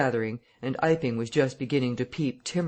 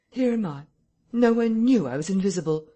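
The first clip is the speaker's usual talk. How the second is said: angry outburst; 7 semitones higher, 5 dB louder than usual. A narrator's reading. Invisible Man's angry outburst.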